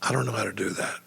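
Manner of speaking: low, hushed aside; soft deadpan undertone to a friend; confiding aside. Retold or imitated. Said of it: "I leaned over to Chris".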